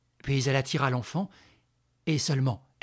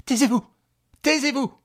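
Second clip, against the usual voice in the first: loud, high, urgent command; shouted order; 12 semitones higher, 9 dB louder than usual. Acted. Mother silences the woman before her son hears, shouting. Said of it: "ordonna Véronique".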